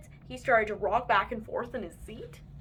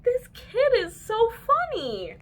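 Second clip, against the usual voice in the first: delighted adults; 12 semitones higher, 7 dB louder, slower than usual. A narrator's reading. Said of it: "They said".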